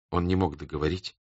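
usual speaking voice

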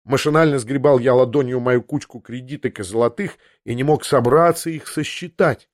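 usual speaking voice

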